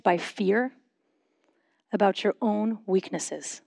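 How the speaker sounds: measured prayerful questions, long pauses between phrases; slow guided reflection. Real, not acted. Pastor leading a congregation in silent reflection before God, slow and measured. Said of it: "I'll say them slowly".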